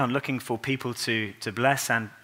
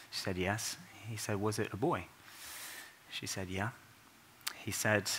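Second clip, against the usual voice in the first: hushed retelling; 10 dB quieter than usual. Retold or imitated. Retelling a hushed, painful exchange.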